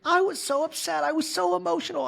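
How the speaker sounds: hammed-up teary high voice; mock weepy Kyle. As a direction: squeak it out like you're crying. Voicing crying Kyle.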